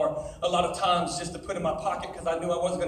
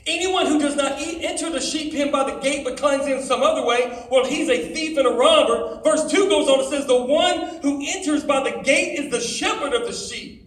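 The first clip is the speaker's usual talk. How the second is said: higher, louder preaching read; preaching scripture read; 6 semitones higher, 7 dB louder than usual. Acted. Preacher reading John ten to teens, higher and louder. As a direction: proclaim the passage to the crowd.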